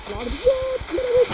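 high startled cry at the cars; yelping in real shock; panicked surprise. Real, not acted. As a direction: shriek in sudden alarm. Cars suddenly appear.

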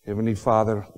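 soft, low prayer voice. Acted. Preacher leading the congregation in prayer, soft. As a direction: pray quietly and reverently.

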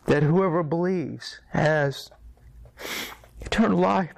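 the words crack as the tears come; tearful realization, voice rising and breaking. Retold or imitated. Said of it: "And his tears just".

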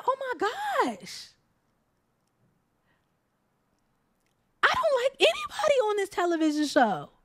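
high-pitched, done-with-it groan about the show; exasperated. Real, not acted. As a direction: throw up your hands at the show.

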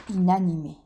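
plain reading voice

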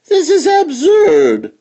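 high, loud outraged fox. Acted. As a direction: explode at the insult. Fox shouting in indignation.

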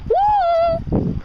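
high loud whoop; excited cheer. Real, not acted. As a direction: let out a happy whoop.

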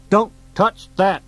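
loud, high alarmed shout; shouted warning. Acted. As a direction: yell it to stop him. Urgent warning as ball grabbed.

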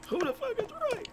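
high, squeaky cartoon voice. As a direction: squeal it in a tiny voice. Squeaky character voice.

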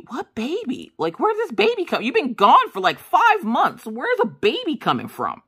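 high-pitched, exasperated disbelief; incredulous outcry. Retold or imitated. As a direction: protest it as if it's utterly absurd. Reacts to husband's baby worry.

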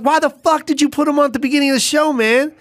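high, incredulous mock outrage; indignant complaint. Host scolding the show's owner over the running order, indignant complaint.

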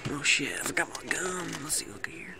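soft unvoiced whisper. Real, not acted. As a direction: breathe it without voice. Whispered aside.

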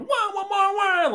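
high-pitched blaring "wah" honks; imitated air horn. Podcaster mimics an air horn after a movie line, blaring honks. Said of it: "a great air horn".

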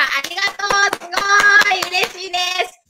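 high, sing-song delighted thanks; 大喜び. Real, not acted. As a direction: cheer the viewer with soaring gratitude. (高い声で歌うように大喜び)

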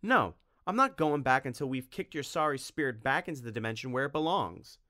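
put-on boy vowing to banish the vampire, loud; defiant declaration. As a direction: stand your ground. Tim defiant against Dracula.

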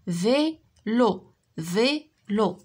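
"Vélo" split into vé and lo with a gap; syllable by syllable. Shown as a demonstration. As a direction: say "Vélo" as two drawn-out beats, vé, lo. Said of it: "Frappe les syllabes".

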